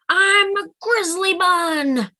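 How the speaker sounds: high, loud, slow mock roar. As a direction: roar it like a tiny fierce bear. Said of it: "she roared".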